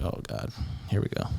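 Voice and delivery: hushed, breathy under-the-breath groan; quiet aside when trouble starts; muttered dread. Retold or imitated.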